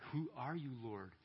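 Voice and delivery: understated, reverent question; awed reverence. Acted. Saul asks who is speaking to him, in mildly awed reverence. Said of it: "awed reverence, maybe with a mixture of dread and fear".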